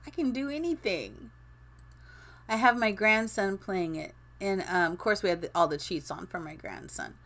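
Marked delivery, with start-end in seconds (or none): 0.1-1.3 much higher, swaggering self-praise; high mock-boastful gamer voice; cocky brag. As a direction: strut like you're the champ. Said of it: "you're like".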